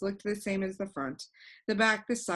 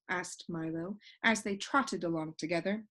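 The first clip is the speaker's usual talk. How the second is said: ordinary delivery, nothing marked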